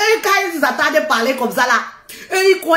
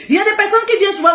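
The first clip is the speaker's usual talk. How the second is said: fast aside; 6 semitones higher, much faster than usual. Real, not acted. Hurried aside.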